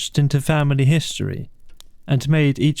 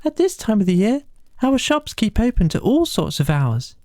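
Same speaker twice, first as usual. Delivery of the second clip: much higher small mouse voice; field mouse; 10 semitones higher than usual. Acted. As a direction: keep the little mouse voice. Field mouse answers the rat.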